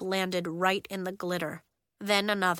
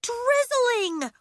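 much higher, louder correction; yelled; 6 dB louder than usual. Acted. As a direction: shout the correction. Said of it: "Willa yelled".

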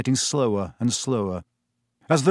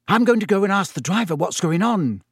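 Hermione voice; 6 semitones higher than usual. Acted.

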